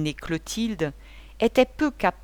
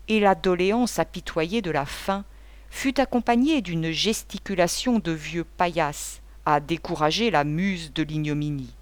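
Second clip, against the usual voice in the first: usual speaking voice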